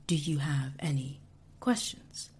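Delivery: usual speaking voice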